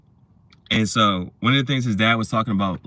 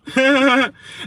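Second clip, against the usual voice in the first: high-pitched burst of laughter; sudden high cackling laugh; 14 semitones higher, 4 dB louder than usual. Real, not acted. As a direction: let out a loud, high laugh.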